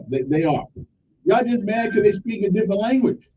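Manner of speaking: usual speaking voice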